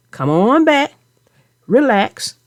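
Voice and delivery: ordinary delivery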